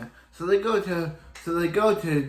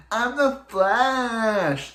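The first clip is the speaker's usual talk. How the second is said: high, drawn-out mock drunken yell; 8 semitones higher, 4 dB louder, much slower than usual. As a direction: bellow it slowly, high and proud like a drunk. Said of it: "Barry just keeps yelling".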